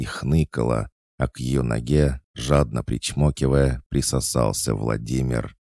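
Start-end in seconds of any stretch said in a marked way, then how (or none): none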